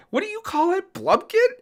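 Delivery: high, puzzled mother-in-law voice; high innocent question, rising on Blumpkin; imitating mother-in-law. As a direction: ask it like an innocent older mom. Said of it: "she's like".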